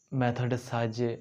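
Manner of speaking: normal talking voice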